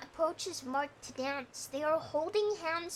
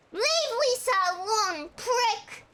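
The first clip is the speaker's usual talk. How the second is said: angry shout; 5 semitones higher, 7 dB louder than usual. Acted. Johnny yells during fight.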